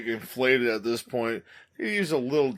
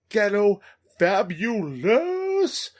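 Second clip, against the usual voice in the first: drawn-out hype; 7 semitones higher, 4 dB louder, much slower than usual. Acted.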